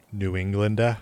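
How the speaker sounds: light mock New England accent; a hint of a New England accent. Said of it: "that accent up there".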